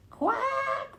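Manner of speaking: high squeaky quack; startled little duck quack. A narrator's reading. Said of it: "went Missus Mallard".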